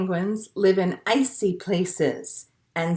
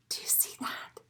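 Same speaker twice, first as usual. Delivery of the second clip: whisper; 17 dB quieter, faster than usual. Real, not acted. Teacher whispers to children about the picture. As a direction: whisper it like a secret.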